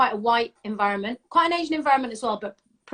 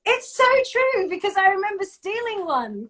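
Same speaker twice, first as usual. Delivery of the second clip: indignant but playful contradiction; emphatic insistence; 9 semitones higher than usual. Real, not acted. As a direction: insist on it, pitch leaping up. Playfully insisting against his denial.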